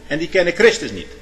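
loud, emphatic preaching, key words lifted high; krachtige preek. Real, not acted. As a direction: preach it forcefully to the congregation. Preacher at full force. (met stemverheffing gepreekt)

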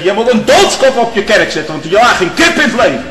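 put-on old man yelling at his pastor in anger; mock furious shout. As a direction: hurl the insult at the minister. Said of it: "ik schreeuwde het uit".

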